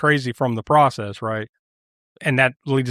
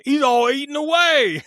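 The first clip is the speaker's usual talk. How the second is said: mock Cockney cop; 11 semitones higher than usual. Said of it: "he's like".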